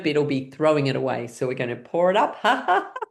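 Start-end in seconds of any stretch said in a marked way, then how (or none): 2.4-3.0 high short ha-has; pouring laugh. Shown as a demonstration. Pouring it up once more, high laugh. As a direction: give a quick high exercise laugh. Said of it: "with laughing".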